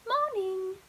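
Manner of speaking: lightly posh, sing-songy reply; a hint of a prim singsong lady; posh sing-song. Crickle answering Henry, lightly posh and sing-songy. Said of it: "in her somewhat posh sing-songy voice".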